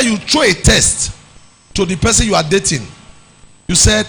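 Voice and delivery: normal talking voice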